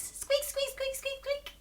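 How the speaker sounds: high squeaky mouse squeak. Shown as a demonstration. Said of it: "the sound that a mouse makes".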